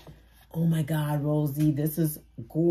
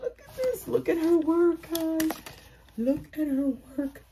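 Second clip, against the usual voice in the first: excited gush; 11 semitones higher than usual. Real, not acted. Showing off the gift.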